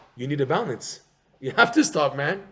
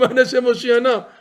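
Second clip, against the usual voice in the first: high, loud pleading cry; a loud, high cry of Hoshiana; screamed prayer; 10 semitones higher, 10 dB louder than usual. Shown as a demonstration. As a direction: scream the plea to heaven. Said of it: "We scream".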